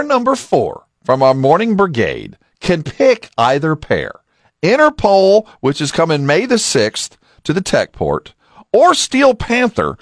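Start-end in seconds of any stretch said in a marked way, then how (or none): 4.6-5.5 high, loud prize callout; excited announcing. Acted. Announcing prize choices on air. 8.7-10.0 high and slow prize callout; drawn out announcing. Acted. Announcing prize choices on air.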